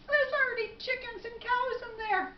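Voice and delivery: high-pitched objection; acted dismay; incredulous protest. Man protests absurd advice.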